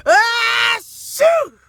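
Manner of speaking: high loud shriek of shock; high yell as the sneeze hits; startled shout. Retold or imitated. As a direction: yelp it at the top of your voice. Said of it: "what do you think happened".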